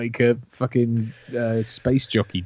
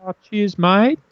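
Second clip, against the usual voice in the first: high-pitched mocking Australian accent; exaggerated Australian thanks, higher; 9 semitones higher, slower than usual. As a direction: thank them in a put-on Aussie accent, pitched up. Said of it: "we are known for our mocking Australia".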